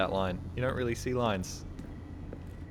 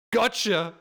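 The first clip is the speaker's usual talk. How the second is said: mock triumphant gotcha; 6 semitones higher, 4 dB louder than usual. Punchline of mocked joke.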